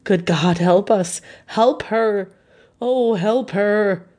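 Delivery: pleading, rising to a slow drawn-out cry; desperate plea. A narrator's reading. Husband beating his hands, a touch of desperate pleading. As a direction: beg for help, hands beating. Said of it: "he beat his hands wildly together".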